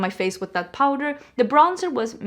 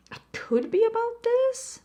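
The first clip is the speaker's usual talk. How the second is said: high squeaky voice; 13 semitones higher than usual. Retold or imitated. Quoting her own reaction.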